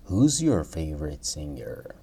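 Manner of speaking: whispered repeat of the question; teacher whispers the model question. Shown as a demonstration. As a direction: say it again in a whisper. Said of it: "teacher repeats model question".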